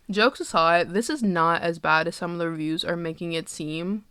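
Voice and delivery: usual speaking voice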